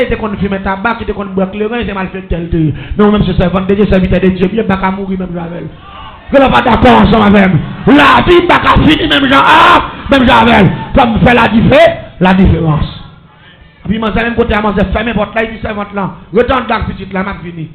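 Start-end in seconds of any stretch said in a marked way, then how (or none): 3.0-13.0 prêche crié, plein de ferveur; proclame-le à toute la salle; sermon prêché à pleine voix. Acted. (prédication criée avec ferveur)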